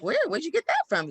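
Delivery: high-pitched, curious questioning; mock intrigued parent. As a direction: play a parent suddenly wanting to know. Voices parents' eager question.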